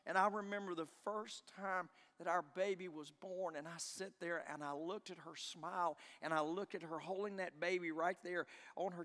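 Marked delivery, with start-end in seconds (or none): none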